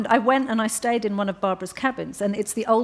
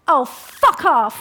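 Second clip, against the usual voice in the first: loud, slow, drawn-out mock shout; a hammed-up slow loud brush-off; retold shout; 5 semitones higher, 7 dB louder, much slower than usual. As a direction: imitate her yelling the lion away. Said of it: "I sat up and I went".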